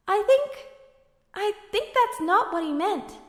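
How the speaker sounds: put-on a boy gently correcting his older brother; boyish hesitant. Alphonse hesitantly correcting Edward, boyish.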